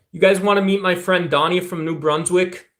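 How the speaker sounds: ordinary delivery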